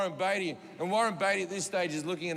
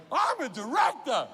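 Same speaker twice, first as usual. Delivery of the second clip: higher and louder, slowed, with passion; passionate declaration; 6 semitones higher, 3 dB louder than usual. Shown as a demonstration. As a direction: declare it like your big moment. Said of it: "I need more passion from you".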